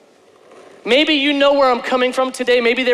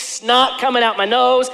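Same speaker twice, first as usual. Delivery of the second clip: usual speaking voice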